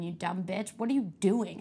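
ordinary talk

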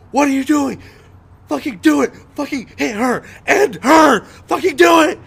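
imitating a furious director's high, loud screaming. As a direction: yell orders at full voice, pitch way up. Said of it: "he's just screaming at me".

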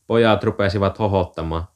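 usual speaking voice

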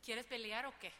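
loud playful "wanna fight?"; mock challenge. Wife answering husband's gorda, loud mock challenge. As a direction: fire it back loud, half joking.

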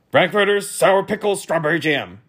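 high, loud officer's bellow; shouted. A narrator's reading. Officer shouting his banquet order from his chair. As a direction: shout it out, dropping a little at the end. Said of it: "shouted Officer Schrift".

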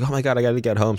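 high, urgent retold panic; panicked. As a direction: blurt it out in a rush of alarm. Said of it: "And she's like".

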